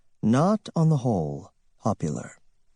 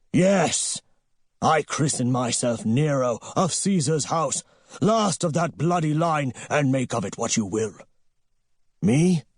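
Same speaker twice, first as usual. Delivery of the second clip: raised, grandiose proclamation voice; defiant cry; 5 semitones higher than usual. A narrator's reading. Nero Golden defying destiny, a grandiose mocking cry. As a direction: hurl it at destiny like a mocking emperor.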